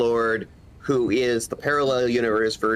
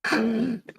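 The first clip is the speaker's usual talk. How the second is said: excited gasp; 10 semitones higher than usual. Real, not acted. Delighted reaction to doggos.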